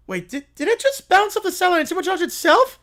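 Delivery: high, loud double-take; surprised disbelief. Real, not acted. Narrator stunned as the beam bounces back. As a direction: catch yourself mid-sentence in shock. Narrator reacting to plot twist.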